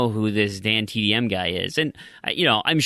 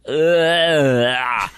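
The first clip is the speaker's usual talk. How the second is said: loud retching ugh of disgust; barf sound; 3 semitones higher, 5 dB louder than usual. Shown as a demonstration. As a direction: fake gagging noise. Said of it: "that's my barf sound".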